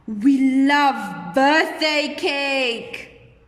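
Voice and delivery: loud, very drawn-out relish; acted delight for a class; excited and happy. Shown as a demonstration. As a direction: savour every word loudly. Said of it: "I'm excited and that I'm very happy".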